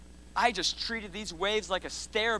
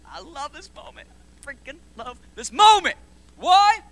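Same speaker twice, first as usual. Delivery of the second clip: excited; 8 semitones higher, 10 dB louder than usual. Real, not acted. Preacher excited about the story.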